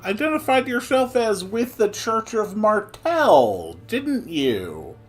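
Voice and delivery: high sing-song mock greeting. Mocking the villain.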